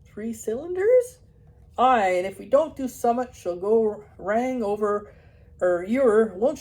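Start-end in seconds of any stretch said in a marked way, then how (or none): 0.1-1.2 high, loud, slow baffled echo; baffled repeat. Acted. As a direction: repeat it in disbelief. Puzzled vet repeats.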